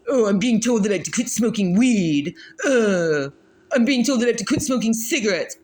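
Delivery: whiny, put-on complainer voice; mock whining. Reader mimics people upset about quitting smoking, mock whining. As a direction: imitate a sulky complaint, hammed-up.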